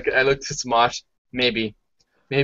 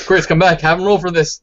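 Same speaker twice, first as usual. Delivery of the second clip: raised, higher-pitched call; 6 semitones higher, 5 dB louder than usual. Real, not acted. Player demanding a roll from the table, calling out. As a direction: call them back urgently to the game. Player calls game master back.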